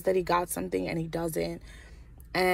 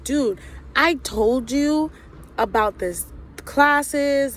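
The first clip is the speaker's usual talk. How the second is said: pissed off, drawn-out and higher; mock-confronting him, slow and raised; retold annoyance; 7 semitones higher, much slower than usual. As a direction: stretch it out in exasperated reproach. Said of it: "I was so pissed off".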